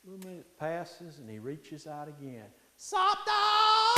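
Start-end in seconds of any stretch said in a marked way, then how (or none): 2.8-3.9 very high, loud sung-out yowl; talking cat. Acted. Cat reaches for the meat again, loud high yowl. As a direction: wail it out long like a tomcat.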